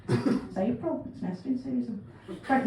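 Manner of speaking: ordinary delivery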